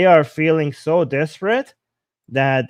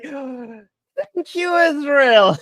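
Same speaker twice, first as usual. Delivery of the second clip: much higher joyful grandma voice; delighted grandma; 10 semitones higher, 3 dB louder, much slower than usual. Retold or imitated. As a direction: gush the thanks like a happy grandma. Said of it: "She's like".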